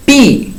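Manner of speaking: "pi" alone, high and stressed; stressed syllable alone. Shown as a demonstration. Teacher isolating the accented syllable, high stressed "pi". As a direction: pitch up and lean on the í of "pi". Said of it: "ahí está el acento en la I".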